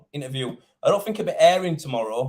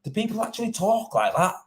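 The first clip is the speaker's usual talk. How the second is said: higher, rising disbelief; incredulous thought; 5 semitones higher than usual. Retold or imitated. Tradesman recalling his reaction to a rude message, incredulous. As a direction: wonder it in higher-pitched disbelief. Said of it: "thinking to myself".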